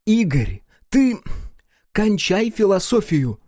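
high, slowed angry snap; exasperated outburst. A narrator's reading. Ex-wife loses patience with her calm ex-husband, exasperated. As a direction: cut him off, fed up.

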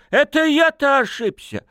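high-pitched offended outcry; tipsy, indignant yelp; indignant wailing. A narrator's reading. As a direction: protest at the top of your pitch. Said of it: "возмущённо заголосил молодой человек".

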